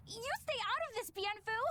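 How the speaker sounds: high, indignant outburst; high-pitched flustered retort; flustered scolding. Acted. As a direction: tell off a meddler, embarrassed. Flustered, snaps at teasing creature.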